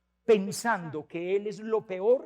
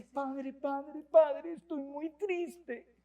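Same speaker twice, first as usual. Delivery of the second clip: put-on sad little boy; 6 semitones higher, much slower than usual. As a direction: plead like a sad boy to his priest. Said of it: "cuando llega un niño de quinto".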